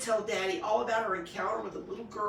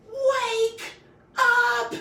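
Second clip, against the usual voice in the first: high, loud scream; 14 semitones higher, 11 dB louder than usual. A narrator's reading. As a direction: scream at the sleeping wolf.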